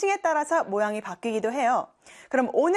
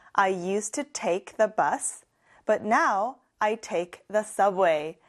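very slow, carefully spaced English; slow classroom demo; slow model sentence; 2 semitones lower, much slower than usual. Shown as a demonstration. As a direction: lay out each word slowly for learners. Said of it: "model sentence repeated".

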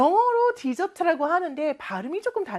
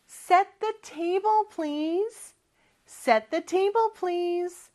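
slow, careful teacher model of the line; slow model phrase; 5 semitones higher, much slower than usual. Shown as a demonstration. Teacher models mom's request slowly for the class. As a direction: spell it out slowly for learners. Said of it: "엄마가 부탁을 해요".

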